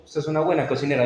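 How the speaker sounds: fast, reluctant compliment; a husband grudgingly praising his wife's cooking. Shown as a demonstration. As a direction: toss it off unwillingly, without heart. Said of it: "de mala gana".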